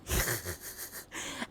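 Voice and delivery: short breathy burst of chuckles; quick, voiceless giggle; laugh. Real, not acted. Streamer amused at sounding like a teacher, laughing. Laughing after a chat remark.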